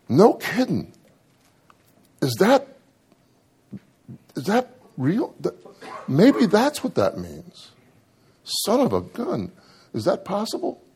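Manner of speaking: mock astonished musing; amazed wonder. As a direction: marvel at a discovery. Retold sudden insight, wonder.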